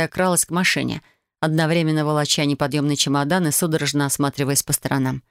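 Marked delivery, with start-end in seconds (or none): none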